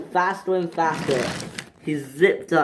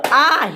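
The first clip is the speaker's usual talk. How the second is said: high, loud "ah"; scream; 10 semitones higher, 6 dB louder than usual. Acted. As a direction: yelp in alarm while flying off. Hugo sent flying in the air.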